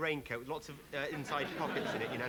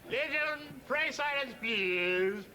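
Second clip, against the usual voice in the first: much higher, loud booming toastmaster announcement; toastmaster call; 10 semitones higher, 4 dB louder than usual. Acted. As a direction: call the banquet hall to order like a pompous toastmaster. Toastmaster calling the room.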